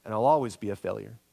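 the harsh self-talk of failure, pitched low; inner critic voice. Retold or imitated. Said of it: "we begin to believe".

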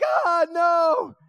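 high, loud mock despair; dismayed cry. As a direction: wail at the failed mission. Reacts to failed mission.

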